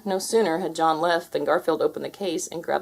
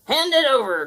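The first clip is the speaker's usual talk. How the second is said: loud, high pirate command; 8 semitones higher, 4 dB louder than usual. Acted. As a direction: bark the order. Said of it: "commanded Blackbeard".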